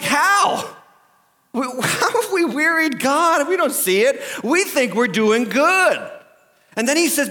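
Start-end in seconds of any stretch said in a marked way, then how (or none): 0.0-6.1 high-pitched, mock-innocent disbelief; oblivious. People answering God's charge, oblivious and high-voiced. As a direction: protest as if you have no clue. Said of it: "they're totally oblivious".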